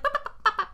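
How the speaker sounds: high, punchy bopping noises; alien clown babble. Retold or imitated. Host demonstrates how the clowns talk, high alien babble. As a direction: imitate clown chatter in loud high blips. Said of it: "in an alien language".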